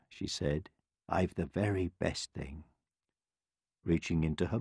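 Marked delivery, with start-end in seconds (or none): 1.1-2.6 put-on low, soft old woman; hushed, kindly assurance; gentle old woman. A narrator's reading. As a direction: confide it quietly. Old woman calmly answers the royals.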